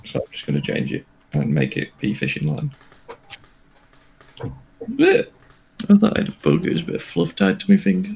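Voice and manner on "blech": high, loud gagging blech; disgusted blech. Real, not acted. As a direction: recoil in disgust. Thought a bug on finger.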